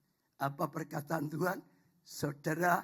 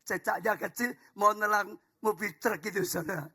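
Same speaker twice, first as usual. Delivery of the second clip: usual speaking voice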